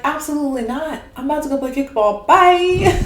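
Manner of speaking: put-on loud, high-pitched kid refusal; quick defiant child, ending on a loud high send-off; inner child. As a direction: blurt it out like a kid bailing. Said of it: "your inner child's gonna be like".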